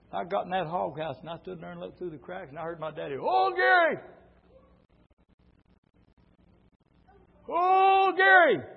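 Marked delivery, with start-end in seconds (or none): 3.2-8.6 long high calling shout. Retold or imitated. Daddy calling his hiding son with a long shout. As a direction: holler for the boy across the yard.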